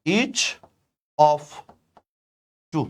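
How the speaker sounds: each, of, two, with long pauses; slow word by word. Shown as a demonstration. Teacher dictating the phrase to class, slow and separated. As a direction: spell the phrase out one word at a time.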